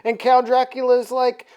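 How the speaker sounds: plain reading voice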